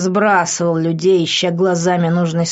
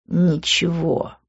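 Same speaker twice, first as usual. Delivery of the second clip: snorted; 4 dB quieter, slower than usual. Acted. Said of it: "фыркнул я".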